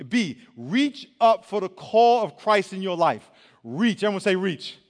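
slow, loud stretched preaching call; preaching at full force. Acted. Pastor calling the youth crowd to reach, loud preaching. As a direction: lean in and drive it home to the crowd.

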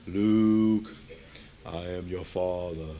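very low, slow, drawn-out monotone; deep villain father. Acted. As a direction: intone it like a menacing movie father. Deep film villain quote after "Luke.

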